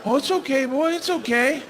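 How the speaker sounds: calm a spooked animal, up in pitch; hammed-up horse whisperer; mock soothing horse voice.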